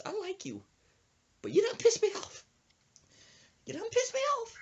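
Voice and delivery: high falsetto granny confiding, then flaring; squeaky old-lady voice with mock indignation; old woman lecturing. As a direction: imitate her telling someone off up high.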